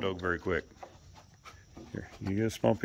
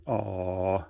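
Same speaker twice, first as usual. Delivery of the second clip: ordinary delivery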